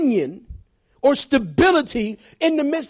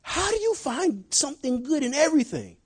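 softer, wondering voice; amazed coworker; 3 semitones higher, 5 dB quieter than usual. Retold or imitated. Coworker asking the pastor, softly wondering. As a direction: ask it in disbelief. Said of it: "they look and go".

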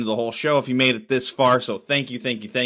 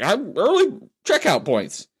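higher put-on voice for the dog; dog's voice; 6 semitones higher than usual. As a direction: speak for the dog Boomer, whiny. Voicing his dog Boomer.

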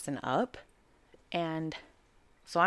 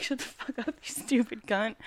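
retold outburst; 5 semitones higher than usual. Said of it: "He lost it".

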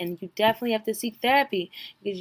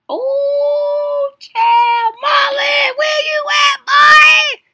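high, loud shriek calling out; shocked shout; 12 dB louder, much slower than usual. Real, not acted. Reacting to the show, yelling for Marlon in shock. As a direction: holler for him in disbelief.

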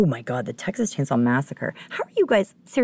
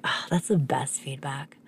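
slow, whispery, touched reaction; awed near-whisper; hushed delight; 2 semitones lower, 6 dB quieter, slower than usual. Retold or imitated. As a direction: breathe it out softly, savoring it. Retells delighted reaction to compliment.

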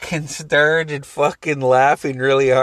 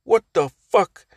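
higher-pitched retold tirade of an exasperated dad; angry yelling, imitated; 5 semitones higher than usual. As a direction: scold the crying baby, acting out dad's rant. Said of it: "he yells at her".

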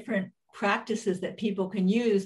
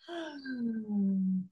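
long sighing exhale; 3 semitones higher, 6 dB quieter than usual. Shown as a demonstration. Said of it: "long exhale out".

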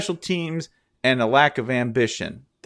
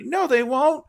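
usual speaking voice

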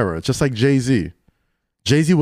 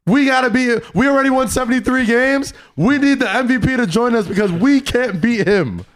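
desperate rival team; 8 semitones higher than usual. Retold or imitated. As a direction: play the scared rivals pleading. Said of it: "teams legitimately clicked up to be like".

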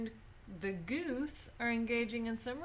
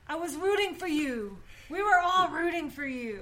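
higher, louder tearful-TV-host plea; Tyra Banks impression; 7 semitones higher, 7 dB louder than usual. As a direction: belt it like a dramatic TV host. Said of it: "Tyra Banks".

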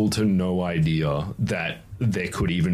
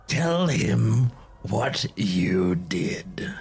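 slow taunting whisper, higher; dark whisper behind you; 6 semitones higher, much slower than usual. Acted. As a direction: tease him slowly from the dark. GM voices hidden daemon.

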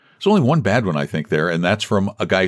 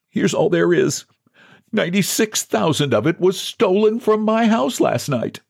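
higher, lightly voiced John, earnest; a touch of despair; 11 semitones higher than usual. A narrator's reading. John to the president, mild despair. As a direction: lay it all out. John's desperate confession.

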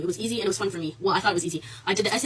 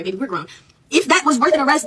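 ordinary talk

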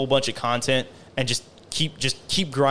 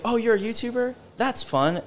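higher-pitched imitation of a clueless acquaintance; mock casual outsider; 9 semitones higher than usual. Imitating people who think YouTube is easy, mock breezy. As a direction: lightly mimic someone making small talk, pitched up.

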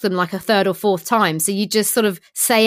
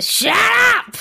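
Peggy Mitchell; 12 semitones higher than usual. Retold or imitated. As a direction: snap it like a cockney landlady. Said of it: "best Peggy Mitchell I've ever heard".

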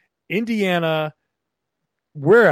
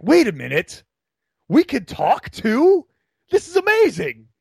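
excited disbelief, climbing higher and louder; amazed; 8 semitones higher, 4 dB louder than usual. Retold or imitated. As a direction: act out a thrilled discovery, voice shooting up. Retelling own amazed discovery.

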